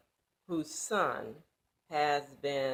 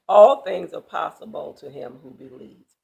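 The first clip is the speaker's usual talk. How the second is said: ringing declaration; 12 dB louder than usual. Acted. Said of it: "Jesus says".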